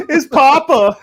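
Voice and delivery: high, loud laughing yell; dad calling out. Retold or imitated. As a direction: holler it like an embarrassing dad. Imitating dad, louder.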